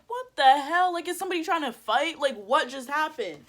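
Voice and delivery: high-pitched, incredulous questions; acting out startled confusion; retold bewildered outburst. Retold confused reaction to shove.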